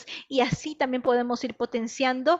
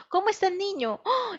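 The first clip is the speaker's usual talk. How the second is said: mock surprise; 7 semitones higher than usual. Shown as a demonstration. Showing how to quiz a child.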